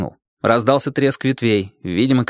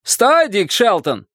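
high, drawn-out command; slow storybook shout, pitched up; shouted order; 9 semitones higher, slower than usual. A narrator's reading. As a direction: call a halt from across the water. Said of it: "крикнул человек на острове".

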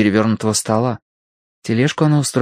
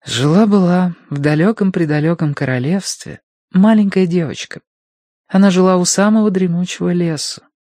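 higher, sing-song fairy tale telling; storytelling voice; 9 semitones higher than usual. A narrator's reading. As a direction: tell it like a bedtime story.

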